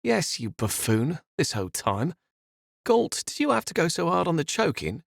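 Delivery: scornful. Acted. Morel scornful, eye-rolling.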